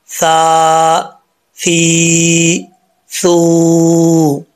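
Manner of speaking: ता, ती, तू each long and sustained; drawn-out Quranic syllables. Shown as a demonstration. Teacher demonstrating the vowel sounds to students, long sustained recitation. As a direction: chant ता ती तू slowly with long vowels. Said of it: "ये इसका sound है".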